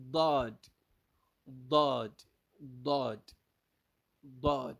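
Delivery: "Dod" with a heavy DH-like D, punched loud; teacher models emphatic "Dod"; Arabic Daad demo. Shown as a demonstration. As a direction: say "Dod" loudly, like D H, not plain D. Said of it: "but like D H".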